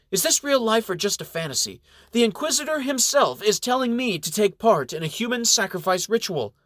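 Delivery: high, outraged disbelief; a monk appalled, voice climbing high; indignant. A narrator's reading. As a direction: protest in shocked indignation. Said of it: "wrinkles his face in indignation".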